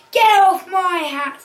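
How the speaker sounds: loud, high acted howl; despairing howl. A narrator's reading. Hat seller losing hats to monkeys, despairing howl. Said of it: "The man howled in despair".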